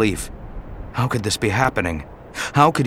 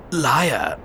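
ordinary delivery, nothing marked

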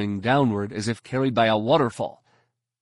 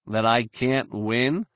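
ordinary delivery

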